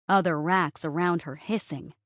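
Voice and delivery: ordinary delivery, nothing marked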